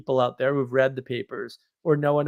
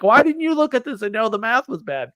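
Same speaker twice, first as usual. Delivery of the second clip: annoyed buddy; 9 semitones higher, 4 dB louder than usual. Retold or imitated. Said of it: "He's like".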